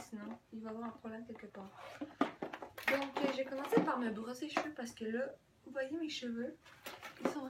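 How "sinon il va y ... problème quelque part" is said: usual speaking voice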